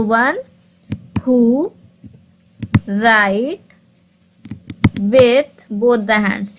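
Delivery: each word slowly, with long pauses between; slow, pausing classroom dictation. Shown as a demonstration. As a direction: dictate it word by word for students to copy. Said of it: "ठीक है, तो".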